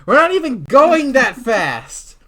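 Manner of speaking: loud, high, drawn-out complaint; acted exasperated yell; exasperated protest. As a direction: cry out in disbelief, stretching the words. Passenger protests the slow scooter.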